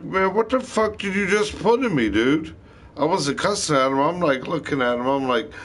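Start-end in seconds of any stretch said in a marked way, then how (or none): 0.0-2.6 alarmed yelp. Retold or imitated. Alarmed after the injection.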